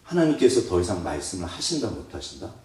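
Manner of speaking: ordinary delivery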